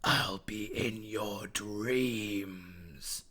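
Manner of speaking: slow, airy, menacing whisper; a creature's voice coiling inside a cat's head; sinister Spider whisper. A narrator's reading. Spider's threat in his head.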